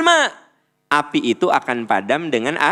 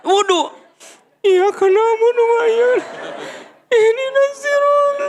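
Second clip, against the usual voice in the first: comic angry worshipper racing through salat; mock furious prayer. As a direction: rush the prayer words as someone still boiling. Mimics furious person praying.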